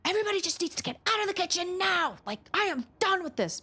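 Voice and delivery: high-pitched fed-up outburst; mock screaming parent at the end of patience; imagined yell. Mom wanting everyone out of the kitchen, pretend high yell. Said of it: "wheel around and go".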